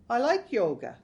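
slightly indignant self-defence; defensive. A narrator's reading. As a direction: say it as if stung by criticism. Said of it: "he was starting to sound defensive".

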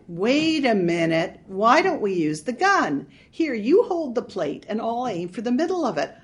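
light up with a bad idea; eager idea. Marge's gun idea.

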